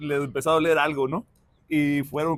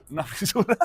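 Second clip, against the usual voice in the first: higher, laughing through the punchline; real laughing; 5 semitones higher than usual. Real, not acted. Host ends anecdote about a missed fissure, laughing. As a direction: break into laughter on the words.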